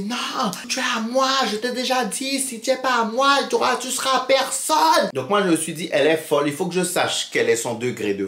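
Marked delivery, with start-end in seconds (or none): 0.0-5.1 high, clingy possessive voice; possessive girlfriend. Retold or imitated. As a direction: refuse to let him go, high and insistent. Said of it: "Elle dit".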